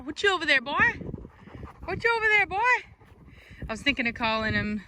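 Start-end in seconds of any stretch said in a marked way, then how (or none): 0.0-3.7 much higher sing-song question; high puppy talk. Real, not acted. Owner asking the puppy in a high voice.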